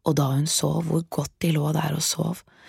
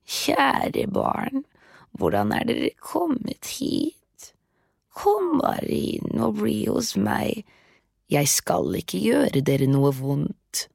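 put-on high, slow coaxing old witch; a witch feigning kindness; sweet-talking witch; 4 semitones higher, slower than usual. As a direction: lure the children in sweetly, slowly. Witch sweetly luring the children.

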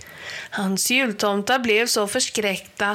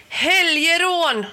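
high, loud, drawn-out call; 8 semitones higher, 5 dB louder than usual. A narrator's reading. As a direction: hail the enemy from afar. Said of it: "ropade Jula".

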